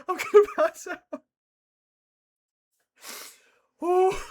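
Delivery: high, loud words through laughter; gasping out words while cracking up; real helpless laughter; laughing hard. Real, not acted. Cracking up at the scene.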